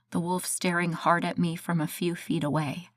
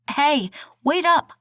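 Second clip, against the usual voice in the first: high, loud call into the woods; 8 semitones higher, 5 dB louder, slower than usual. A narrator's reading. Narrator calling after the wolf, loud call. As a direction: shout after the wolf, drawn out. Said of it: "I called".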